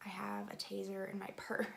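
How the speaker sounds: hushed, low whisper; whispered confession. Retold or imitated. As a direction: whisper it like an awkward admission to the cop. Sheepish whispered confession to cop.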